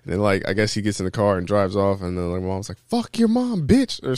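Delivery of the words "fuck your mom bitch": retold shouting match. Insult thrown as driver drives off, mock yell. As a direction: imitate a parting insult, pitched way up. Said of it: "they get in a shouting match".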